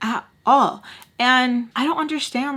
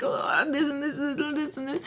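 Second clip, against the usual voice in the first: higher, softer, fed-up list; exasperated rant; 6 semitones higher, 6 dB quieter than usual. Retold or imitated. Telling viewers about all the drama behind the scenes, fed up. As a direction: groan through the list like you're sick of it.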